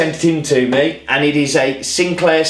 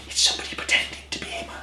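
a quick, quick secret whisper; hushed confiding whisper; whispered aside; 11 dB quieter, faster than usual. Real, not acted. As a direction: let the viewers in on a secret. Conspiratorial aside to viewers.

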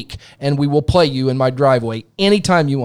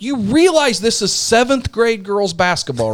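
pitched-up, pointed disbelief; retold incredulous retort; 7 semitones higher than usual. Parent coach answering rival coach's taunt, incredulous. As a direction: lean on it in mock disbelief.

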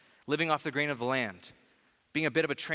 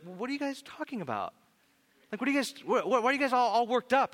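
playful Jesus; 8 semitones higher than usual. Retold or imitated. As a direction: playfully tease the worried disciples. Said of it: "super playful".

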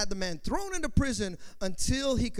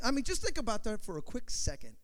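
ordinary talk, nothing marked

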